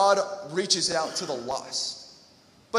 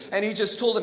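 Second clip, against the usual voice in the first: ordinary talk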